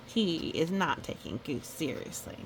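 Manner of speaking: usual speaking voice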